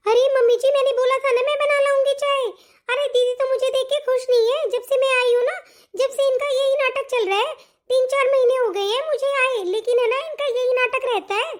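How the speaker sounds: high-pitched, put-upon whine; aggrieved complaint. Acted. Younger daughter-in-law tells mother-in-law of sister-in-law's drama, aggrieved.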